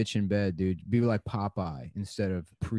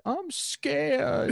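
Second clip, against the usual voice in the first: high, squeaky frightened whine; scared wimpy voice; 4 dB louder than usual. Retold or imitated. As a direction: whimper it like a scared little boy. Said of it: "And you're like".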